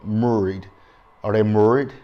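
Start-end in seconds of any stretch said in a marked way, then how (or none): 0.0-0.7 attempted Baltimore accent on "Murried". Shown as a demonstration. Vlogger mimicking how the cast talks, in an amateur Baltimore accent. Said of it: "I'm probably doing a terrible accent". 1.4-1.9 louder attempted Baltimore accent on "Murried". Shown as a demonstration. Vlogger questioning the cast's word, in an imitated Baltimore accent. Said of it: "I'm probably doing a terrible accent".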